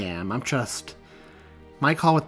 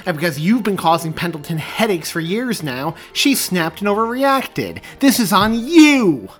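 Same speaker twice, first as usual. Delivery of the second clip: angry accusation; 8 semitones higher, 10 dB louder than usual. Acted.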